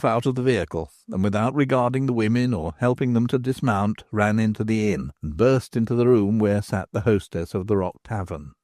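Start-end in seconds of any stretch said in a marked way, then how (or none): none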